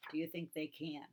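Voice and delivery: ordinary delivery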